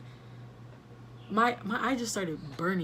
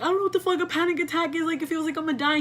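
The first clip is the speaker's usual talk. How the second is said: higher, faster, in a frantic pitched-up rush; panicked outburst; 8 semitones higher, 3 dB louder, faster than usual. Retold or imitated. Daughter panicking to her dad, in a high frantic rush. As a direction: blurt it out, sure you're about to die.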